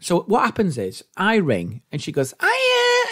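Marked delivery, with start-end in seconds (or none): 2.4-3.1 high, delighted mum hello; mock-cheerful mum on the phone; imitated happy greeting. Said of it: "she's dead happy at the start".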